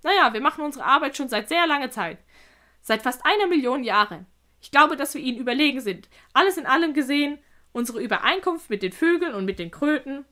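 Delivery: lightly voiced creature making its case; confident spider. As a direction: explain it assuredly as the spider.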